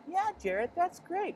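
very high chirpy praise; host praising Jared's dinosaur fact; cheesy kids' host. Retold or imitated. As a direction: gush like a fake-cheerful TV host. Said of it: "And they're like".

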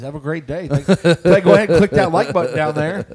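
high, loud channel outro; hammed-up upbeat host plugging the like button; mock YouTuber sign-off. Parodying the crime host's outro, loud mock cheer.

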